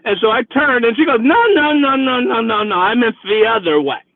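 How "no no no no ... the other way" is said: a tipsy passenger panicking; a hint of a flustered drunk; drunk woman protesting. Drunk woman correcting driver.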